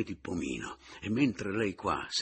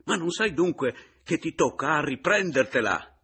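high, loud, agitated burst; heated, raised-voice protest; almost angry; 9 semitones higher, 8 dB louder than usual. A narrator's reading. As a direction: snap at him, exasperated and urgent. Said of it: "scattò Roberto quasi rabbiosamente".

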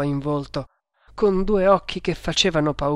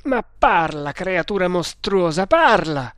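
louder command building to a yell; angry yell; 3 semitones higher, 4 dB louder than usual. Acted. Tremal Naik orders the silent prisoner to speak, yelling. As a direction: demand an answer, loudest on the last word. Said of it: "urlò Tremal Naik".

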